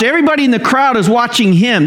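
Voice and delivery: ordinary delivery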